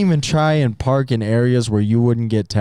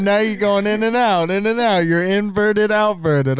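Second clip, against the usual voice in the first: much higher, mocking play-by-play; teasing commentary; 10 semitones higher than usual. Friend mocking his flirty glances, high teasing commentary. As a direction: tease him like a sports commentator.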